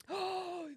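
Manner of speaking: sharp gasp. Real, not acted. Shocked by game reveal.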